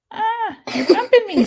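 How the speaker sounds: loud high protest; sharp startled complaint; annoyed yelp. Real, not acted. Child keeps bumping her while painting.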